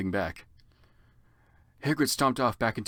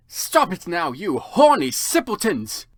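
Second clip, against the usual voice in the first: loud, slow scolding shout; teacher's slow scolding shout; 6 semitones higher, 7 dB louder, slower than usual. Acted. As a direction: scold the students sharply. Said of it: "shouted Professor McGonagall".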